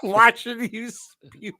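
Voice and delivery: high, slow, helpless laughing squeal; voice cracking upward. Real, not acted. Watching a friend spew, high-voiced helpless laughter. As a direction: squeeze it out while dying of laughter. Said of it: "my voice went up, like, six octaves".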